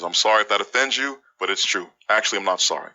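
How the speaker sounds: ordinary talk, nothing marked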